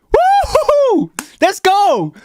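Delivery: real high, loud, slow whoop of joy; loud excited whoop. Real, not acted. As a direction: let it rip, cheering a bouncing chart. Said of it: "sorry if this is a bit loud".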